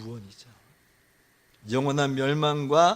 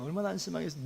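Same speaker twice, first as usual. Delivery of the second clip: much softer, dropping away; subdued aside; 9 dB quieter, much faster than usual. Real, not acted. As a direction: let it fall to a quiet echo. Soft repeat of the exclamation. (작게 읊조리듯)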